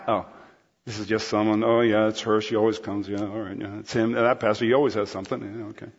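low, monotone bored muttering; checked-out indifference. Acted. Listener writing off whoever is at the microphone, low bored voice. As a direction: tune out, low and dismissive. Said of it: "do we just kinda check out".

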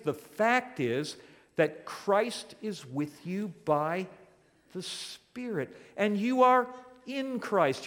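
loud, ringing pulpit voice; fervent preacher pressing the point home; preaching at full force. Acted. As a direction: proclaim it to the whole church. Sermon rises to full preaching.

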